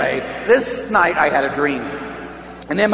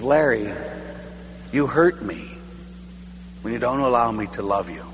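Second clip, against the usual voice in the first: understated hurt, lower voice with pauses; hurt, crying God; 5 semitones lower, 6 dB quieter than usual. Retold or imitated. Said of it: "God's crying".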